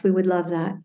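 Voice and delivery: ordinary talk, nothing marked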